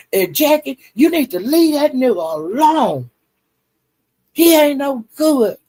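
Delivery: high, scornful advice; mock warning. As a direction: imitate Brandon warning her off, pitched high. Retold warning to Jackie.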